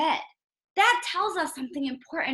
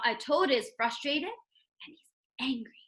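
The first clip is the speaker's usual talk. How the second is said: normal talking voice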